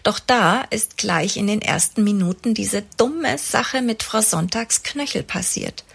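usual speaking voice

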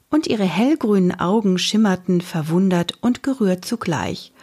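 usual speaking voice

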